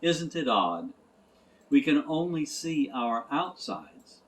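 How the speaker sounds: ordinary delivery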